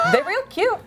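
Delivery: high, cutesy mock gush. Reading the cat ad aloud, gushing over the kittens.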